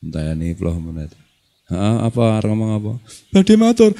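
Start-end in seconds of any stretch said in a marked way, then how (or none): 3.3-3.9 high, louder student pleading to be heard; insistent student. Acted. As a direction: push in again, begging to speak. Santri insists a third time.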